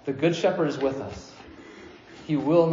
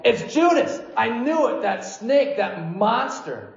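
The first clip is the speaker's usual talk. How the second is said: high, excited pretend outburst; a hammed-up gotcha cry; mock outraged accusation; 6 semitones higher, slower than usual. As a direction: blurt it out like a disciple who knew it all along. Mock disciples accusing Judas.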